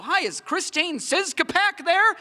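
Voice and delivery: high, slow telemarketer mangling a name. Retold or imitated. Telemarketer asking for mom by the wrong name, high and slow. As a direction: sound politely scripted, a bit too high. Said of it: "and they'd go".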